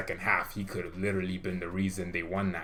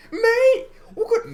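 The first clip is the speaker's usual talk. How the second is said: put-on much higher, loud Scouse Merseyside accent; 9 dB louder, slower than usual. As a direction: launch into a hammed-up Merseyside accent. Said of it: "That's my Merseyside accent".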